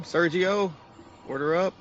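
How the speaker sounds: loud high call across the kitchen. Acted. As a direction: shout the order to the cook. Calling the cook in game.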